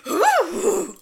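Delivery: high, loud silly ooh; imitating Aaron's ooh. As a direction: mimic Aaron's startled ooh. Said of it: "I loved Aaron's, like".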